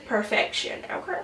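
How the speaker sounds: soft voiceless whisper of approval; whispered praise. Acted. Vlogger sealing her palette review, whispered. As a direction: breathe the word out like a satisfied secret.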